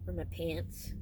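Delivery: ordinary delivery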